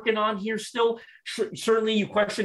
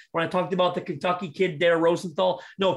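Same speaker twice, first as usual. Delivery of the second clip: ordinary talk, nothing marked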